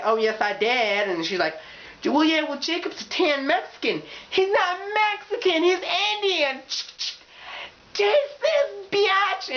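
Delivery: put-on very high, heated girl fight; shrill catfight voice. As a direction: escalate into a full catfight. Catfight escalating to knife.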